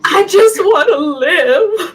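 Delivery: high, slow, laughing mock plea; mock pleading. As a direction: beg for your life, hammed-up. Mock plea not to be sacrificed.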